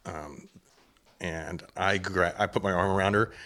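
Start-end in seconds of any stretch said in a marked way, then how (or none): none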